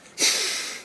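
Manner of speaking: breathy sigh with no voice. Shown as a demonstration. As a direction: let the air out in a plain sigh. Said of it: "you're not breathing out like this".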